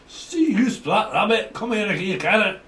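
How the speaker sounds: high, loud mock Scottish; Scottish accent. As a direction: put on a rough-and-ready Scottish accent, high-pitched. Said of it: "if that's a Scottish accent".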